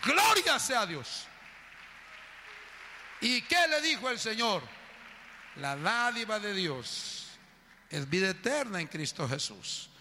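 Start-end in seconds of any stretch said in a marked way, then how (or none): 0.0-4.6 preaching at full force. Acted. Pastor proclaims forgiveness to the congregation, high shouted preaching. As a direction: proclaim it over the whole church.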